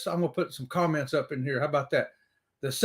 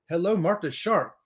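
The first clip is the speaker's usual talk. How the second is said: ordinary delivery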